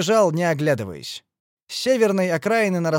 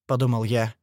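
ordinary delivery

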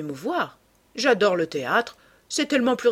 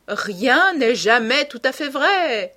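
slow, higher, louder retort; 5 semitones higher, 6 dB louder, slower than usual. A narrator's reading. Lord Henry answers Hallward back, emphatic retort. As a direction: riposte with drawn-out emphasis, falling at the end. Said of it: "riposta Lord Henry".

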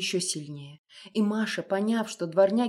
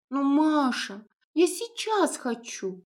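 put-on higher, slow whining little girl; whiny capricious child; 6 semitones higher, slower than usual. A narrator's reading. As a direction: whine it like a spoiled child. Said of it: "капризно законючила Лиза".

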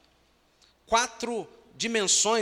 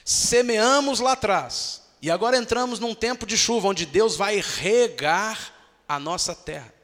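louder, rising fervent proclamation; loud sermon climax; full-force preaching; 4 semitones higher, 5 dB louder than usual. Acted. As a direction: preach it out to the congregation.

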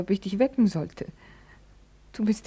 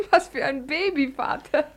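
laughing; 5 semitones higher than usual. Acted. Laughing at father.

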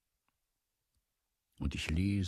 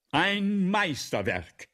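loud, slow, higher praise; enthusiastic praise; 7 semitones higher, 5 dB louder, much slower than usual. Acted. The director praising Balzac's play with delight. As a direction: gush over the masterpiece. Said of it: "Der Direktor".

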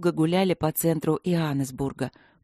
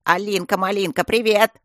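louder, higher cheerful call; 3 semitones higher, 5 dB louder than usual. A narrator's reading. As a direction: holler a greeting across the hall. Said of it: "начали голосить".

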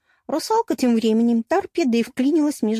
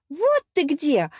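higher, friendly call; оклик; 6 semitones higher, slower than usual. A narrator's reading. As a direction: hail a friend just found. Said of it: "окликнула меня Ариана". (радостный оклик)

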